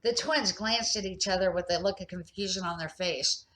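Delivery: ordinary delivery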